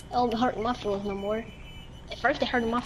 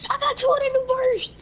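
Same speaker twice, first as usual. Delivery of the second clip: much higher, louder, slow yell; excited shout; 4 dB louder than usual. Real, not acted. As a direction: yell the loot find, thrilled. Excited loot find.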